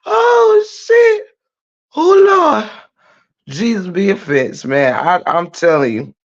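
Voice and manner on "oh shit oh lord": high squeal out of a big laugh; laughing from the soul. Real, not acted. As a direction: gasp it out high, helpless with real laughter. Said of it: "my laugh done been from my damn soul".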